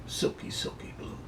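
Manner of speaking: soft unvoiced whisper; whispered tagline. Real, not acted. Handicapper whispering his Godolphin tagline, soft. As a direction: breathe the tagline secretly.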